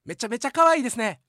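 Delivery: compliment yelled out loud. Retold or imitated. Junior loudly telling the receptionist she's cute. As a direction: blurt the compliment at full volume.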